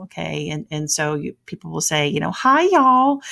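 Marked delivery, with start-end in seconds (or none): 2.3-3.2 loud, high Southern drawl greeting. Shown as a demonstration. Teacher demonstrating how Southerners greet people, Southern drawl. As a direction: draw it out in a big Southern drawl. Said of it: "we speak with a drawl".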